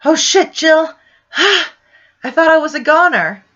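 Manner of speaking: high, loud, crying out in fright; frightened cry. Retold or imitated. To Jill after almost falling in the puddle, frightened cry. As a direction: shriek it like you nearly fell to your death. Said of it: "I said".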